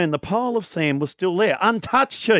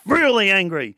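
usual speaking voice

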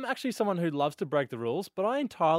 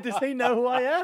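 high, pointed mimicry; mock self-important outrage; 9 semitones higher, 3 dB louder than usual. Co-host insisting on what his mate said, high mock outrage. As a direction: throw it back at him, pitched up and affronted. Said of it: "You said".

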